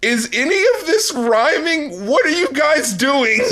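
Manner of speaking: high, whiny bafflement; baffled, high-pitched. Retold or imitated. Listener confused by the rhymes, high and whiny. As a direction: squeak it out like a lost listener.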